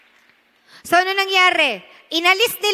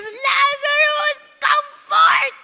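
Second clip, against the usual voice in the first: stretched-out thundering name; commanding shout; 11 semitones higher, much slower than usual. Retold or imitated. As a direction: call across the tomb with all your might. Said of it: "Sumigaw pa siya".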